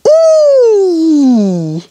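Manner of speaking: "E" held very long as "ee", high then falling; long French E. Shown as a demonstration. Contrasting French with English for learners, long high "E". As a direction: draw "E" out into a high "ee". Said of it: "But in French".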